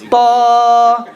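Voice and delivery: crisp emphatic Ta with a long held vowel; model letter ta. Shown as a demonstration. Teacher modelling Ta for students to judge. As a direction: say Ta slowly and clearly for the class. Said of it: "I'm gonna say it and you tell me".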